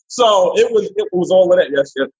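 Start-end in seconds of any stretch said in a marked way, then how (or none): none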